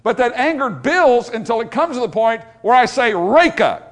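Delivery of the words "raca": loud, contemptuous name-calling; acted contempt. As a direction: spit it out as a scornful insult. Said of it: "That's an expression of contempt".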